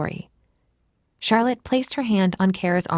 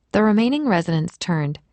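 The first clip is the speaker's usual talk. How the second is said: ordinary delivery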